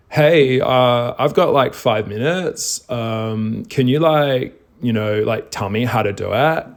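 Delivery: put-on valley girl accent, casual and ditzy. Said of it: "Doing my Valley Girl accent there".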